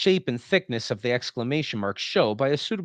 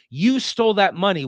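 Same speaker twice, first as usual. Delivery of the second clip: "You stole that money" pitched up in reproach; disapproving tone; 8 semitones higher, 6 dB louder than usual. Shown as a demonstration. Teacher voicing moral disapproval, higher. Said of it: "which is an action I disapprove of".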